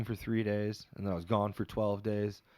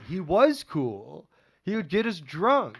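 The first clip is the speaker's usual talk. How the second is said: higher, louder imitation of a bartender insisting; earnest defence; 6 semitones higher, 6 dB louder, slower than usual. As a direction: gush in defence of him, stretching it out. Bartender earnestly defends her professor.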